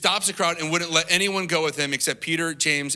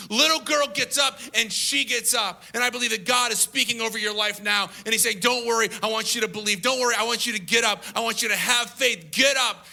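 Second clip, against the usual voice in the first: raised, ringing sermon delivery; impassioned preaching; 5 semitones higher than usual. Acted. Preacher speaks God's promise over listeners, impassioned preaching. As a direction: preach it high and emphatic.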